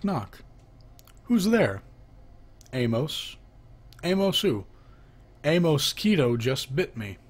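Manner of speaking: ordinary delivery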